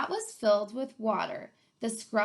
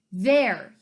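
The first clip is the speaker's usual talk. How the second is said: a louder, curt snarl; cranky varmint snarling; 8 dB louder than usual. Acted. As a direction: bite it off in irritation. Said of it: "he snarled".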